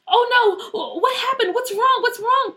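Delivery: high-pitched panicked questions; frantic. A narrator's reading. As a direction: blurt it out in alarm for a hurting friend. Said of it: "Peach said frantically".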